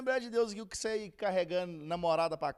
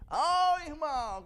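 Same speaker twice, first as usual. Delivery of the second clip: drawn-out, higher, louder heckle; mock shout; 7 semitones higher, 6 dB louder, much slower than usual. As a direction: imitate kids yelling at a church brother. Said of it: "Começou a gritar". (grito arrastado, imitando)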